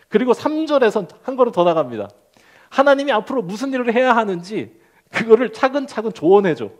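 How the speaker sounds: normal talking voice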